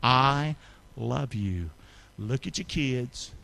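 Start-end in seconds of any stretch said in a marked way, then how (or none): 0.0-1.7 I love you said slowly; slow, earnest. Shown as a demonstration. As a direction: lean into each word slowly. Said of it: "Would you say that with me?".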